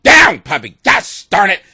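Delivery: loud scolding a dog; mock scolding. Woman scolding her man like a dog, loud mock scold. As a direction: bark the command at the puppy. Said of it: "you were like".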